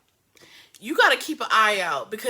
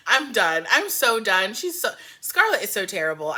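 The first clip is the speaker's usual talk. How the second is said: ordinary talk, nothing marked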